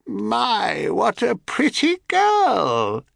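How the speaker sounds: high, slow, overdone cackling hag; cracked cackling crone. A narrator's reading. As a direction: ham up a cracked old crone voice. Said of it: "slightly overdoing the cracked and cackling voice".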